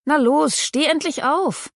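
high, slow, drawn-out wake-up nag; urging call. Acted. Andy drags her sleepy boyfriend out of bed, calling high and slow. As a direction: chivvy him out of bed, pitched high.

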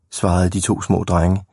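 usual speaking voice

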